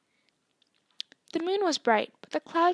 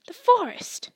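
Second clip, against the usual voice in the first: higher, louder startled cry; rattled exclamation; 8 semitones higher, 8 dB louder than usual. A narrator's reading. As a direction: let the cool slip in alarm. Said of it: "didn't sound quite as cool as usual".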